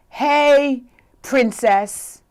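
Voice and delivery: loud, high street call. Retold or imitated. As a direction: imitate a man hailing a woman.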